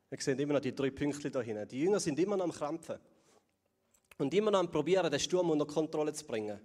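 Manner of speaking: usual speaking voice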